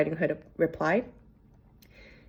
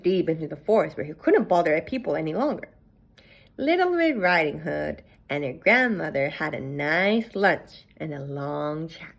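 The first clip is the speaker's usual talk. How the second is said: ordinary delivery, nothing marked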